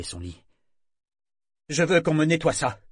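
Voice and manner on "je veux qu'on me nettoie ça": loud, raised-pitch command; firm. A narrator's reading. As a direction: order it as a captain to crew. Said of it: "Il s'efforça de prendre un ton ferme".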